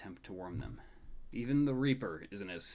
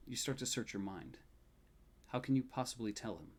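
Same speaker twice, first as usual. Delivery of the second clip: ordinary delivery, nothing marked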